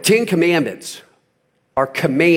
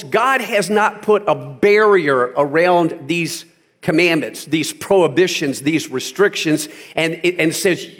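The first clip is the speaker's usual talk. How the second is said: normal talking voice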